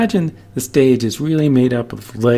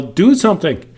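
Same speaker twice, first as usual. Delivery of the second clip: loud, higher-pitched mock yell; demonstrated yell; 6 semitones higher, 6 dB louder than usual. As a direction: call it out loud and high, as an example. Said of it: "You would yell".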